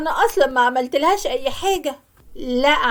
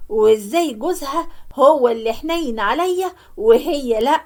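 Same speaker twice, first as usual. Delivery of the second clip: normal talking voice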